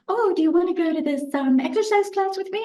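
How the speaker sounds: imitating a friend, bright and high; mock eager friend. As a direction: friend inviting her to exercise class, mock chirpy. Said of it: "They'll say".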